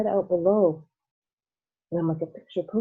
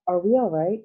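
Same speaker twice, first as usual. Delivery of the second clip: louder, worried question; anxious cry; 3 semitones higher, 6 dB louder than usual. Acted. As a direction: call out nervously. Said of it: "cried Grandpa Joe".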